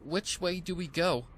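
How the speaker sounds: called out. Acted. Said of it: "Sprout called out".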